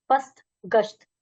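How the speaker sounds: पस्त with "s", गश्त with "sh"; s versus sh. Shown as a demonstration. Teacher drilling word pairs, पस्त against गश्त, separately articulated. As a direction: contrast the middle sounds of पस्त and गश्त. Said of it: "उच्चारण करते हुए अंतर समझिए".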